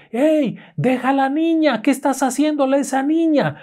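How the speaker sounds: high-pitched alarmed aunt yelling; acted shout. Aunt confronts whatever is hurting the girl, shouting. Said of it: "le grita".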